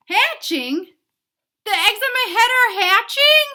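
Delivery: hammed-up panic; panicked shout. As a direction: cry out in horror. Gerald panics.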